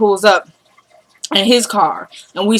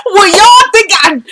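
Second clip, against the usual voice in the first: overwhelmed; 11 semitones higher, 6 dB louder, slower than usual. Real, not acted. Host still overwhelmed.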